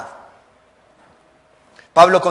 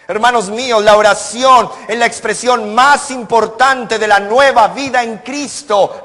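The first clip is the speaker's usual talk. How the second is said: higher, louder climactic pulpit cry; preaching at full force; 5 semitones higher, 4 dB louder than usual. Acted. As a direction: hammer home weakness without prayer. (clímax de sermón, más alto y fuerte)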